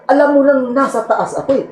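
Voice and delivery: high, fast mocking retort; indignant thief. Thief mocks the owner's question, fast indignant and laughing. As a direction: scold him with a laugh.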